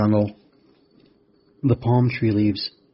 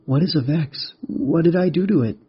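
put-on high-pitched child's questioning; worried boy; 7 semitones higher, 3 dB louder than usual. Rex asking the mantis what he did, high worried child voice. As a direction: ask it high, rising with worry.

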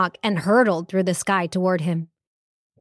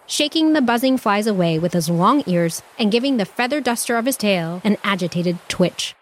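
ordinary delivery, nothing marked

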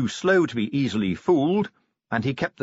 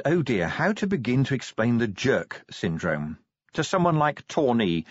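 ordinary delivery, nothing marked

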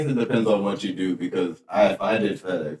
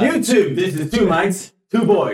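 hammed-up intro; 8 semitones higher, slower than usual. Co-host tries the channel intro, hammed-up high announcer. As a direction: belt out the show's opening, high-pitched.

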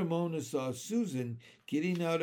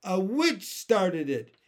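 tearful; 4 semitones higher, 7 dB louder, slower than usual. A narrator's reading. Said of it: "said Susan tearfully".

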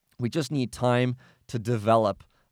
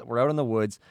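ordinary talk, nothing marked

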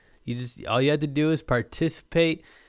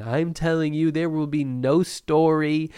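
ordinary talk, nothing marked

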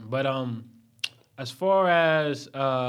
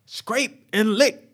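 high, drawn-out cartoon quote; slow mock cartoon call; cartoon catchphrase; 6 semitones higher, 3 dB louder, much slower than usual. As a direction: stretch out each word, pitched up.

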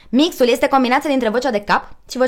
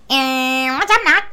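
Bugs Bunny; 3 semitones higher, slower than usual. Shown as a demonstration. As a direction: open on a drawn eh, then squeal the catchphrase. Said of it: "zici că ești Bugs Bunny".

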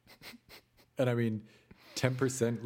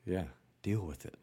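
whisper. Real, not acted. Joking about technical issues.

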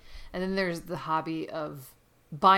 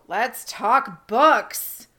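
slow and drawn out, louder, like a show host; big segue; 4 semitones higher, 9 dB louder, much slower than usual. Real, not acted. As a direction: announce the topic with relish. Host launching the main topic.

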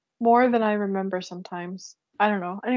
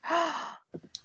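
sharp surprised gasp; 7 semitones higher, 8 dB quieter than usual. Real, not acted. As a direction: suck in a quick breath. Recalling the answer suddenly.

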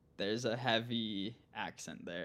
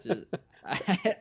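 laughing; 9 semitones higher, 7 dB louder than usual. Real, not acted. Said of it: "I need to work on my Minnesotan accent".

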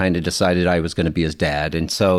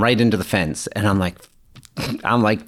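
ordinary talk

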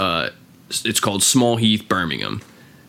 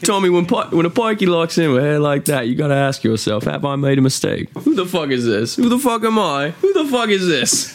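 higher and louder, building into laughter; hammed-up gangster rant; 8 semitones higher, 4 dB louder than usual. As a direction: rant like a wild Birmingham gangster. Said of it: "he's like".

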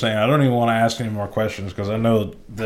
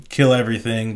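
ordinary delivery

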